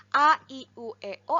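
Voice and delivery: A, I, U, E, O as separate, distinct vowels; vowel-by-vowel enunciation of A, I, U, E, O; clear articulation. Shown as a demonstration. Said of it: "artikulasina teh kudu jelas".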